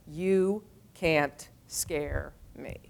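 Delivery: very slow, weighted "You can't scare me"; slow and defiant. Shown as a demonstration. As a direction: lay down each word like a vow. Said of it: "you get four words".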